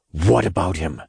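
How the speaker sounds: tense, guarded growl. A narrator's reading. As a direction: growl it with restrained anger. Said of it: "I growled".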